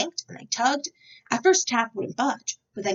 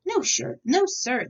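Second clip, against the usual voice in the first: taken aback; 6 semitones higher, slower than usual. A narrator's reading. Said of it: "was taken aback".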